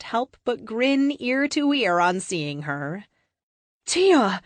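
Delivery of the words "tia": higher, louder happy call of a name; excited shout greeting an old friend; joyful cry. Acted. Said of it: "Kate cries".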